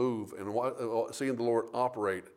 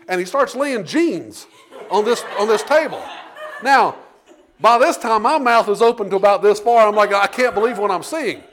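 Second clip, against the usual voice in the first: laughing amazement; 8 semitones higher, 10 dB louder than usual. Real, not acted. Amazed storyteller laughing at miracle.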